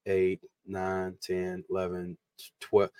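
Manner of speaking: softly counting platforms to himself; counting under breath. Real, not acted. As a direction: murmur the count half to yourself. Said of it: "hold on, let me count".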